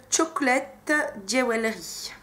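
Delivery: English words in a heavy French accent, drawn out slowly; slow, French-accented attempt at English; bad English accent. Real, not acted. Vlogger reading an English eyeshadow shade name, French accent. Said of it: "Désolée pour mon accent un peu pourri".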